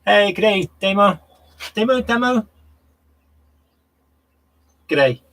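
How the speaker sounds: a cheery Australian g'day to a viewer; Australian accent. Pretend. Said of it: "My best Australian accent".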